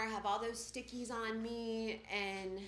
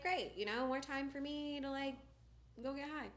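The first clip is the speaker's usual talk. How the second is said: higher, mock-cheerful "great"; sarcastic glee; 8 semitones higher than usual. Retelling her sarcastic joy at a longer stay. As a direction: fake delight at being sent far away.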